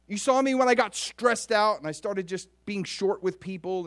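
high, earnest, insistent admission; self-deprecating confession. Acted. David admitting weaknesses to God, earnest high confession. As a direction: confess your flaws in amazed protest. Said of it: "David's like".

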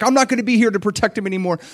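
rushed, high, loud plea; urgent acted prayer, pitched high; crying out. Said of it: "He's just, like, crying out".

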